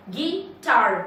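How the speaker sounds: "Guitar" split as गि, टार; syllable by syllable. Shown as a demonstration. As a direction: say "guitar" in two separated beats. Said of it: "दो sound निकलते हैं". ("Guitar" को तोड़कर, गि... टार)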